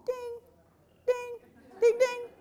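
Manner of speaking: put-on high sung bleeps like an old video game; Pong beeps. Speaker recalls Pong for the audience, high beeping imitation. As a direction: chime each ding up high like a game sound.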